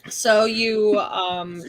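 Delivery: normal talking voice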